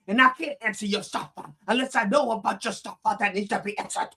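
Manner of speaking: high, heated preacher rant; mock scolding. Reverend fussing about missing emails, mock exasperated.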